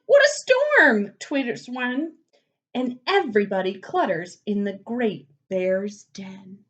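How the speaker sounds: put-on lively read-aloud for kids, slowing on the refrain; storybook reading. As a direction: read it like bedtime story time for little ones. Reads picture book to children.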